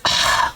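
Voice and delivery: breathy sigh, imitating him; imitated sigh. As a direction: let out a mock sigh. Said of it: "And then he sighs".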